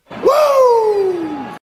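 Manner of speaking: high, loud rising "woo" of praise; joyful woo. Real, not acted. Preacher rejoicing after praising God, a loud exultant woo. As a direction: let out a jubilant woo.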